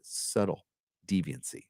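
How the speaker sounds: low and soft, drawn back; understated name repeat. Real, not acted. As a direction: let the name drop away quietly. Says donor name again, subtly.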